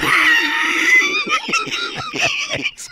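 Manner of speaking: long, high burst of laughter; cracking up. Real, not acted. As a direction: laugh helplessly at the joke. Cracking up at a joke.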